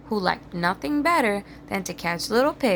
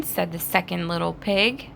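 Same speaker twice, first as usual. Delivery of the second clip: usual speaking voice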